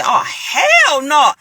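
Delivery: appalled, slow rising protest; shocked refusal. Retold or imitated. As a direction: stretch it out in loud alarmed refusal. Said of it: "I said".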